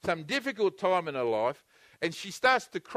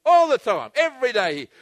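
forceful preaching; 3 semitones higher, 8 dB louder than usual. Real, not acted.

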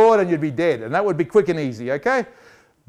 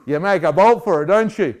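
normal talking voice

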